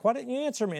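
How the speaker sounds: high exasperated call up the stairs; a parent asking why nobody answered; calling to the kids. Retold or imitated. Dad upstairs scolding the kids, high and exasperated. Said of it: "I'm like".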